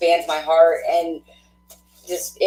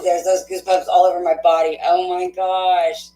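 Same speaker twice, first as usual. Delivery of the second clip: normal talking voice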